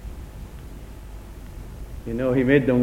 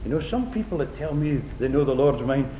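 usual speaking voice

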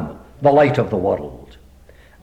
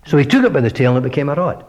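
ordinary talk, nothing marked

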